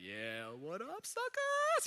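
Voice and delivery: preacher imagining Joseph taunting his brothers, mock gloat. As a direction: tease them like a smug winner.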